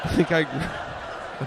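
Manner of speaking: slow, laughing self-satisfied remark. Real, not acted. Host laughing after nailing the read. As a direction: chuckle it out slowly.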